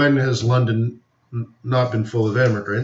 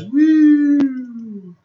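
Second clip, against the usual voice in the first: excited whoop; 12 semitones higher than usual. Real, not acted. Cheering at a crazy market move.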